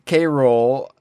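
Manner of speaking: usual speaking voice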